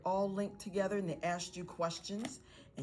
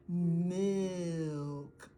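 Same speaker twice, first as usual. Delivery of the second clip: "Milk" stretched out very slowly; slow motion. Shown as a demonstration. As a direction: draw out every sound in "Milk". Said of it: "Talk in slow motion".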